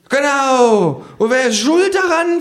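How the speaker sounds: high kangaroo voice, cursing; grumbling kangaroo. Acted. Kangaroo cursing while drinking, high grumble. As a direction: rail against the culprit. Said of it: "flucht das Känguru".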